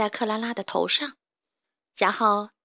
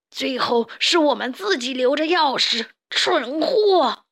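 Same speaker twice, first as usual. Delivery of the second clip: exasperated scolding, rising on the insult; angry villain correcting his underling; furious boss; 3 semitones higher, 3 dB louder, slower than usual. Acted. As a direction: berate a dim henchman. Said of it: "普老大简直气疯了".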